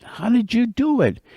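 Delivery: high-pitched, incredulous question; baffled beaver. Acted. Beaver asks the winning turtle, bewildered. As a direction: ask it high in disbelief.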